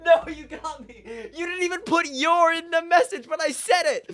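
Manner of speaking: high, loud protest breaking into laughter; exasperated outcry. Real, not acted. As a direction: wail in laughing indignation at being caught out. Caught out by chat's word game.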